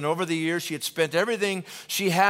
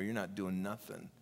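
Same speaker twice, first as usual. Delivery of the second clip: usual speaking voice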